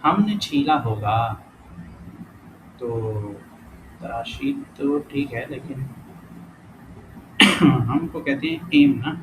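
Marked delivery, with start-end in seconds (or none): none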